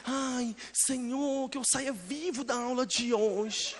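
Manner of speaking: soft, very slow mock plea; preacher mimicking a scared student's soft prayer; mock fearful prayer. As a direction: pray meekly to survive class.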